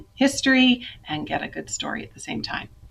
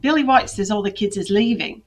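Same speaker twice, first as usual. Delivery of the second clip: light Cockney London accent, a touch higher; 4 semitones higher, 5 dB louder than usual. Shown as a demonstration. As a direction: try a Cockney boy's voice. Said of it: "I should try and do my Cockney accent".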